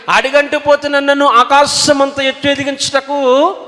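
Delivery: high, ringing pulpit voice; fiery preaching. Acted. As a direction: preach it out with full force to the congregation. Climactic preaching.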